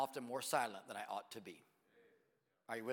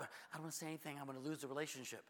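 mock timid excuse; 5 dB quieter than usual. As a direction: play a reluctant parent backing off quietly. Voices timid parents' excuse.

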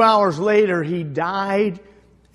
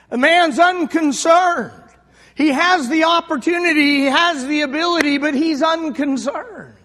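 fervent sermon delivery; preaching; 10 semitones higher, 6 dB louder than usual. Acted. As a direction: drive the point home from the pulpit. Preacher hammering a point.

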